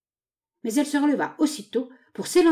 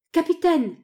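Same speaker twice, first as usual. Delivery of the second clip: high, loud shout; urgent high-pitched hail; calling out; 10 semitones higher, 5 dB louder than usual. A narrator's reading. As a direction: call to him across a crowd. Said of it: "lui cria-t-elle".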